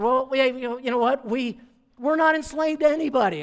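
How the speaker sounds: high, loud defiant protest; indignant boast. Retold or imitated. Crowd protesting to Jesus, loud indignant denial. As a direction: insist loudly, voice up high.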